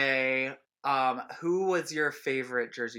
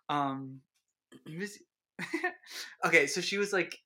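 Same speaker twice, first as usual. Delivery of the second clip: normal talking voice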